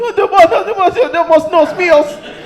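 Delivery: high, loud wailing plea; hammed-up sobbing plea; imitating drunk crying man. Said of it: "He's like".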